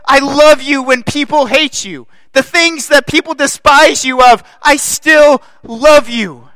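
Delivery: loud, high, declaring love; God's voice. Acted. Pastor voicing God to the church, loud and high. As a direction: call out God's love to them.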